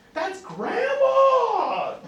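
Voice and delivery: high, loud, very slow laughing announcement; insistent parent. Retold or imitated. Parent presenting grandma to a crying child, high and laughing. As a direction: sell grandma to the kid with a laugh.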